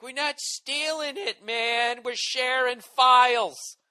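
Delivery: high, slow, dopey mock voice; mock file-sharer. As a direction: play the clueless downloader, drawn out. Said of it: "And everybody's like".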